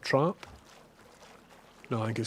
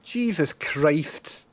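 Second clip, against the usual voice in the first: startled swearing; 5 semitones higher, 5 dB louder, much slower than usual. Real, not acted. Attacked by panther in game.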